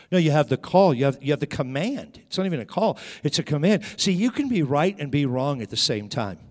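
ordinary talk, nothing marked

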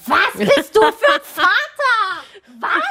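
high, loud indignant shout; outraged yell. Retold or imitated. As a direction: yell at the clueless father in disbelief. Outraged at the father. (empörter Ruf an den Vater)

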